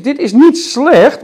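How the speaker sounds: niet drawn out, slow; stress on niet. Shown as a demonstration. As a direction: lean on niet, slowly. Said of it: "maar het is niet goed".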